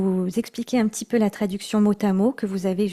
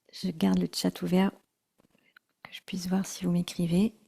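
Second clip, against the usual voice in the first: 1 whispered aside; 3 semitones lower, 6 dB quieter, faster than usual. Real, not acted. Aside during the practice.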